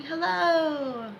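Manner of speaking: high, sustained greeting; called-out hello. Shown as a demonstration. Coach guiding students into imagination, called out. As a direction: send the word out on a long high note. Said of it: "See yourself saying".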